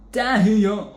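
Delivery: Taiyo sung nasal and loud. Shown as a demonstration. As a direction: put it in the nose. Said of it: "did you just hear how my voice became nasal".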